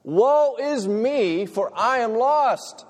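high, loud, slow lament; anguished cry. Shown as a demonstration. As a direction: cry it out like a lost soul. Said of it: "Have you ever cried".

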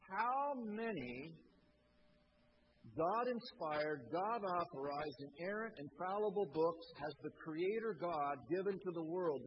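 slow, loud rhetorical question from the pulpit; preaching. Real, not acted. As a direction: build it up like a preacher asking the crowd.

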